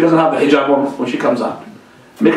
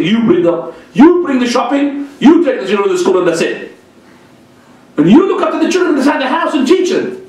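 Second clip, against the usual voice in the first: raised, bossy husband handing out chores; a mock domineering husband, pitched up high; husband laying down orders; 9 semitones higher than usual. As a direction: rattle off the wife's duties like a demanding husband. Husband gives wife orders.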